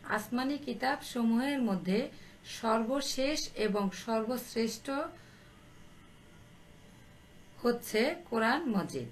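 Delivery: stretched phrases with long pauses; slow dictation. Real, not acted. Teacher repeating the answer so pupils understand, slowly. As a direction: repeat it slowly so they can write. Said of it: "আমি আস্তে আস্তে বলছি".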